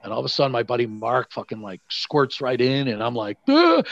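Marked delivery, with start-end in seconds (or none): 3.5-3.8 high, loud "ah!"; short hammed-up yell as you take off; mock charging yell. Teller bolting after his buddy through the door, yelling. Retold dash past the doorman.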